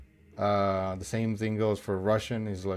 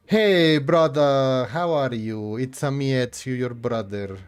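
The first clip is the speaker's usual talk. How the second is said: mock Italian accent; 3 semitones higher, 5 dB louder, slower than usual. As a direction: greet your brother like a cartoon Mario in a mock Italian accent. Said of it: "when he speaks like Mario".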